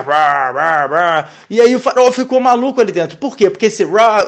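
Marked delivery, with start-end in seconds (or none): 0.0-1.3 coaxar de sapo imitado. Retold or imitated. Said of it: "fazendo ele craquejar".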